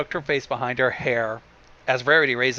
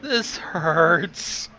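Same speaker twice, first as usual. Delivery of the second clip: pained groan; 3 semitones higher than usual. Real, not acted. Groaning at the bad puns.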